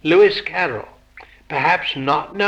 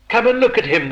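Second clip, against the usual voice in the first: lightly raised, higher storybook call; mild calling cry; 6 semitones higher, much faster than usual. A narrator's reading. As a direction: read it as an understated eager cry. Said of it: "the brothers cried".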